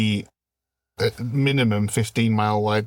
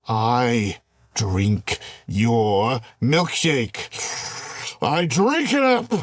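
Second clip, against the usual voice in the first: very slow gloating climax; much slower than usual. Acted. Villain climax.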